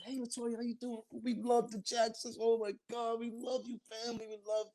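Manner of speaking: high, starstruck gushing; mock fan chorus fawning over a star; imitated gushing fans. Young fans greeting a star.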